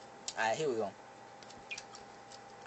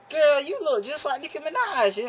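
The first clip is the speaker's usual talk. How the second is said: flirty cousin; 11 semitones higher, 3 dB louder than usual. Retold or imitated.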